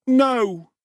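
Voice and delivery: high, defiant no; a small boy refusing to be afraid; bold boy. A narrator's reading. Oliver answering Bumble boldly, high and defiant. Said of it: "replied Oliver boldly".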